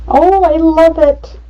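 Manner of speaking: delighted gush. Real, not acted. Delighted with the photo layout.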